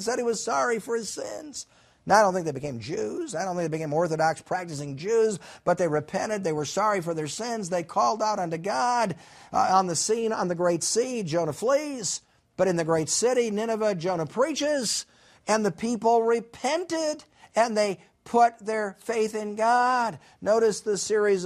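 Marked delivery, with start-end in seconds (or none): none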